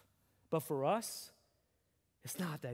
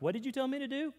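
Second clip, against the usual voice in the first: put-on high little-kid voice, puzzled; forgetful child; 10 semitones higher, faster than usual. Parent telling the church about a child, high puzzled kid voice. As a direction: play a kid who already forgot. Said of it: "and they're like".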